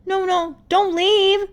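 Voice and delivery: very high, loud desperate cry. Acted. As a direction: beg them not to go. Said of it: "Rumi said desperately".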